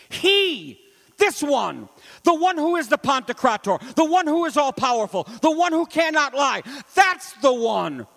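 chanted sermon climax, slowing at the end; preaching crescendo. Acted. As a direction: build the chant to a peak.

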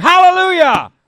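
high, loud ringing "Hallelujah"; preacher shouts the heavenly hallelujah; shouted hallelujah. Acted. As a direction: cry it like a roaring multitude. Heavenly multitude's cry.

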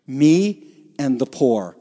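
Me and the poor, slow, each word leaned on; slow weight on Me and on poor; stress on me and poor. Shown as a demonstration. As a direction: punch Me and poor apart. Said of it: "the words that are emphatic in the Greek are the word me".

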